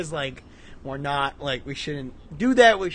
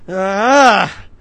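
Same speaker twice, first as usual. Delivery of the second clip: startled yelp; 4 semitones higher, 13 dB louder than usual. Real, not acted. Startled by "wake up.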